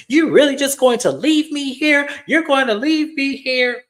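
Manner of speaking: distraught girlfriend impression. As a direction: imitate her pleading protest way up high. Retold girlfriend protesting being left behind.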